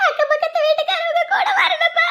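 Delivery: high-pitched sobbing lament; wailing in tears. Acted. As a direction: pour out the hurt through sobs. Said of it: "ஏக்கா இப்படி அழுவுற".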